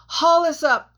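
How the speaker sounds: loud, high scream. A narrator's reading. Jack screaming up to the miners to haul. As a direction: scream it up the cliff. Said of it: "Jack screamed".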